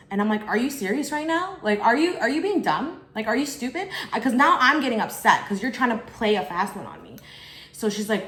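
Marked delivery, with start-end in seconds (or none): 0.5-3.9 high, rising, exasperated questions; indignant. Retold or imitated. Confronting the fake police caller, exasperated and indignant. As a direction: challenge her like she's playing you.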